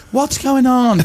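high-pitched mock confusion; bewildered crowd. Crowd baffled by the chain of hosts, mock bewilderment. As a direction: imitate a puzzled crowd. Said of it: "everyone goes".

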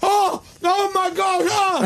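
high startled yelps; shocked by chili heat. Real, not acted. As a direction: react to a burning mouthful. Tasting the spicy bread.

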